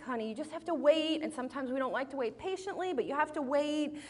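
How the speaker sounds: high, soft coaxing tone; patient parent. Retold or imitated. Mother telling upset son to wait, gently coaxing. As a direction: gently reason with a child, voice lifted and softened.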